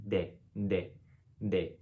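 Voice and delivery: De with the tongue near the teeth; light, forward de; dental d. Shown as a demonstration. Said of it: "tongue is almost in between the teeth".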